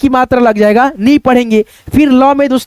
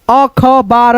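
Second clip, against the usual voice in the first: अखबार broken up as अ-ख-बार; slow, separated reading of अखबार; split into parts; much slower than usual. Shown as a demonstration. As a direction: sound out अखबार piece by piece, अ, ख, बार. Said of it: "दो भागों में divide करके".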